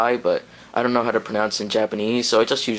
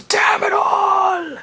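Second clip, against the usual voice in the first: slow, loud high shout; Vegeta cursing; 5 dB louder, much slower than usual. Retold or imitated. Vlogger imitating Vegeta cursing in fury, slow shouting. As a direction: bellow the curse, drawn out. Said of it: "and then he goes".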